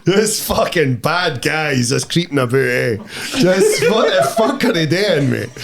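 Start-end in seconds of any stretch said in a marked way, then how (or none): 3.4-5.5 laughing. Real, not acted. Laughing at creepy bad guys.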